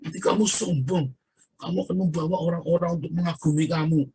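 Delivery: imitated complaint, held high throughout; complaining friend. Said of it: "komplain besar gara-gara saya ngaku nabi".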